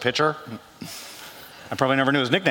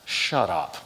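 disbelieving shut up; 9 semitones lower, 4 dB quieter than usual. Retold or imitated. Disbelief at friends' claim.

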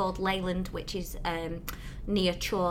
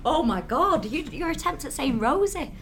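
mock shock; 7 semitones higher, 3 dB louder than usual. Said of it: "I'm like".

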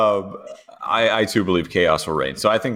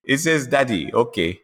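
imitated French accent, higher pitched; Esteban; 6 semitones higher than usual. Imitating a driver reading his bracelet, higher French accent. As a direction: do a playful mock French accent. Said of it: "I love your Esti accent".

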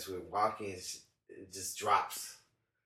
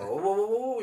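alarmed whoa; 11 semitones higher, 7 dB louder, faster than usual. Retold or imitated. Catching a stumbling drunk friend.

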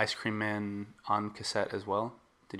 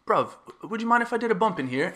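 high-pitched, quick mock-British voice; bad British accent; 12 semitones higher, 6 dB louder, faster than usual. Man asking a stranger in the bathroom stall, bad British accent. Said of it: "I'm really bad at doing British accents".

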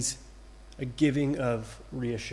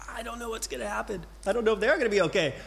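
quick, fretting mock worry, pitched higher; inner panic; 7 semitones higher, much faster than usual. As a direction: rattle off the inner worry fast. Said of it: "I respond that way inside".

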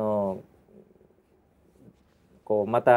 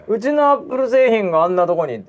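higher, indignant rant; angry boss voice; 7 semitones higher, 4 dB louder than usual. Retold or imitated. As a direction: scold the store like a betrayed boss. (怒鳴る上司の声)

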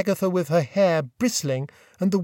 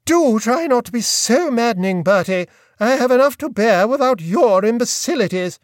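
higher, louder withering rebuke; exasperated aunt; 5 semitones higher, 5 dB louder than usual. A narrator's reading. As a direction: crush his nonsense with scorn. Aunt voiced in story.

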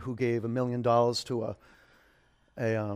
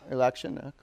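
ordinary delivery

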